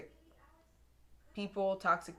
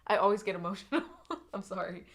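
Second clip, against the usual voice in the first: laughing through real emotion, louder at first; teary laugh, apologizing to the viewer; emotional laugh; 4 dB louder, faster than usual. Real, not acted. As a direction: laugh it off while choked up. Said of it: "I'm getting kind of emotional here".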